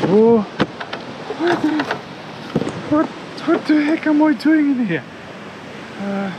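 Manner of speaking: ordinary talk, nothing marked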